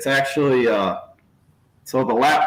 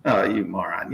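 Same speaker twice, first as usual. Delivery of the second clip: muttered scorn; 6 semitones lower, 4 dB quieter than usual. Retold or imitated. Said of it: "sitting watching this video going".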